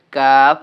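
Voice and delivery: কাফ said slowly and loudly, held long; loud slow letter reading. Shown as a demonstration. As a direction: call out কাফ slowly, like a teacher. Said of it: "একটু মোটা করিয়া পড়তে হবে". (ধীরে জোরে কাফ উচ্চারণ)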